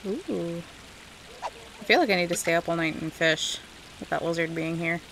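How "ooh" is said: normal talking voice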